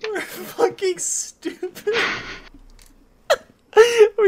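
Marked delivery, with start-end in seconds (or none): 0.0-2.0 laughing. Real, not acted. Still laughing at mistake.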